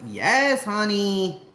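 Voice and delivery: high, loud, drawn-out affirmation; loud, soaring, theatrical assent; Jesus. Acted. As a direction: belt it out as an emphatic, hammed-up yes. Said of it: "Jesus is like".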